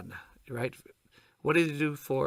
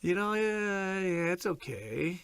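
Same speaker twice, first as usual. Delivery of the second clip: reluctant; 5 semitones higher, slower than usual. Acted. Friend giving reluctant answers.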